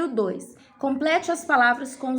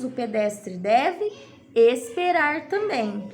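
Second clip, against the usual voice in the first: drawn out, rising on deve, then a pause; slow, deliberate teacher voice; slow dictation; slower than usual. Shown as a demonstration. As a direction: spell it out slowly for children. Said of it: "teacher dictating answer slowly".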